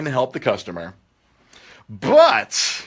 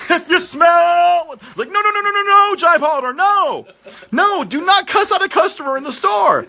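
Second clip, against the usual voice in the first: much higher and louder, frantic no no no; panicked high yelling; 4 dB louder than usual. Retold or imitated. Manager shrieks at coworker to stop cussing, high and loud. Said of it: "Not knowing what was happening".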